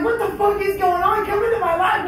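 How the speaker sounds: high, loud frazzled yelling; frantic exasperation. Retold or imitated. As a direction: yell the prayer through your exasperation. Distracted prayer, bee bumping.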